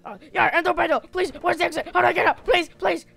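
loud, high-pitched frantic pleading; hammed-up trapped panic; panicked shouting. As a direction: beg at full volume to be let out. Trapped among endless clarinets, begging out.

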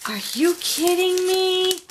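can't believe the gift, voice jumps high; thrilled squeal of disbelief. Real, not acted. Opening a surprise gift.

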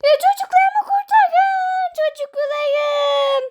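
high, slow, drawn-out wail; desperate play plea. Pretend. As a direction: cry out hammily for the children. Parent begs to save children. (abartılı feryatla yalvarma)

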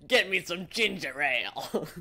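high, loud, slow mock whine; imitating sick Mario. Friend repeating the sick Mario quote, high mock whine. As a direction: parrot his sick plumber line. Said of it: "that specific quote".

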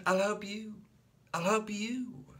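put-on high eager child offer; high childish offer; eager little boy. A narrator's reading.

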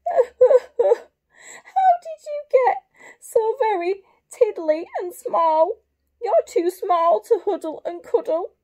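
loud, high storybook wail; bawling. Acted. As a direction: bawl it out for the children, high and loud. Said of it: "hear the voice bawl".

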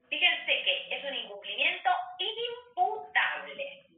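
"imputable" drawn out slowly and weighted; stress on imputable. Shown as a demonstration. Teacher stressing the key legal word to students. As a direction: lean hard on "imputable", slowly. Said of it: "acá remarco la palabra".

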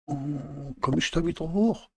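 ordinary talk

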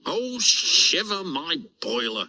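high startled engine cry; a cry of cold dismay. Acted. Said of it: "cried Oliver".

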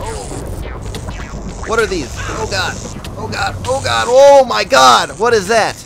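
loud, high burst of alarm; oh-god freak-out, high and loud; startled panic. Real, not acted. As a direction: cry out in rising panic at a sudden threat. Startled by threat in game.

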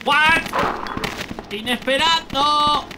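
high, loud startled yell; shocked shout. Real, not acted. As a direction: blurt out in shock. Sorpresa en el juego. (sorpresa gritada)

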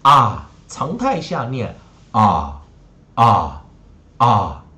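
啊 as a loud, open, isolated "ah"; open "ah" sound. Shown as a demonstration. Teacher models the short vowel for students, open 啊 "ah". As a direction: model 啊 loudly, falling, pausing between repeats. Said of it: "一个念啊".

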